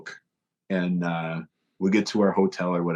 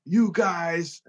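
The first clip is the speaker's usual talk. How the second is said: much higher and slow, put-on exasperated voice; mock outrage; 12 semitones higher, much slower than usual. European tour manager groaning at pranking bandmates, high slow mock outrage. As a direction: draw out the groan of being fooled again.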